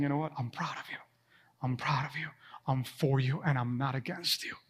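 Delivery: tender hushed reassurance, barely voiced; God's whisper. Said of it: "The whispers of God".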